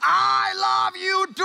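high, long-drawn answering shout; comic lovesick yell, stretched out; yelling lover. Acted. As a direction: holler back slowly to a faraway sweetheart. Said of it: "Lovers don't yell".

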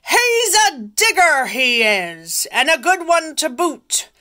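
loud, high pirate shout to crew; pirate yell. Acted. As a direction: holler it across the deck. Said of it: "he yelled back at his crew".

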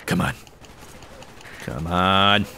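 ordinary talk

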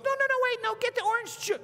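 very high squeaky wife; tiny little voice. Retold or imitated. Tiny wife ordering her husband about, high squeaky voice. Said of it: "this tiny little voice".

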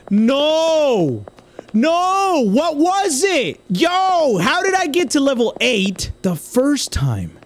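high, drawn-out wail of frustration; exasperated cries, pitch way up; anguished outcry. Real, not acted. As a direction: howl in disbelief at losing. Lost the run, despairs.

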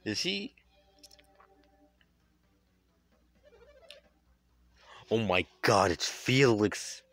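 stunned, slow gasp of shock; reacting to the big reveal. Real, not acted. Reveal of Felix.

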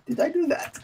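high squeaky cartoon nerd voice; exaggerated cartoon intonation. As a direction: pitch it way up, goofy and innocent. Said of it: "Why are you talking like that?".

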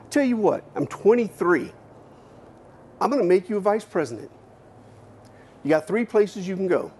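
high Texas boss making an offer. Retold or imitated. Owner offers the young man a vice presidency, high country voice. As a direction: deal it out like a generous good-old-boy. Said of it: "He calls me in again one day".

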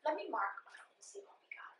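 whisper. Real, not acted. Speaks quietly while writing.

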